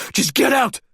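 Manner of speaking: high-pitched, frantic order; enraged scream. A narrator's reading. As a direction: scream them out the door. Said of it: "Maglev screamed".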